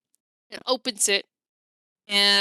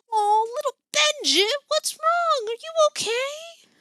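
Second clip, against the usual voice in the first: high, sweet, worried auntie voice; doting aunt asking what's wrong; concerned auntie; 4 dB louder than usual. Acted. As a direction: coo over the child with worry.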